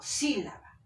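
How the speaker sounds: sílaba with a strong first syllable, SÍ-la-ba; stress on SÍ. Shown as a demonstration. Teacher giving the correct word, sílaba stressed on SÍ. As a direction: hit SÍ, let la-ba fall away. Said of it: "la fuerza de voz está en sí".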